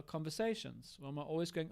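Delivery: ordinary delivery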